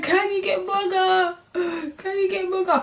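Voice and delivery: high, crying plea for help; half-crying high call for her partner; distressed plea. Retold or imitated. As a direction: beg for him as if about to be sick. Calling partner while about to vomit.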